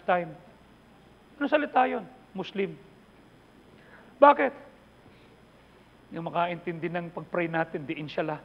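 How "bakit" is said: loud, sharply raised "Bakit?"; shouted question. Real, not acted. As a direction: throw the question at the crowd. Preacher asks the crowd why. (malakas na sigaw na tanong)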